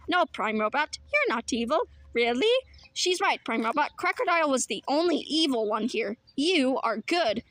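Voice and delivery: ordinary talk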